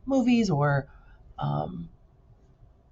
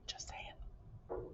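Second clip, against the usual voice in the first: whispered aside; 25 dB quieter than usual. Real, not acted. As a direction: toss it off under your breath. Teacher's whispered aside to class.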